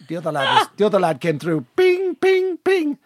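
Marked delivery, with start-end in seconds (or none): 1.8-3.0 phone chime imitation. Imitating a text alert.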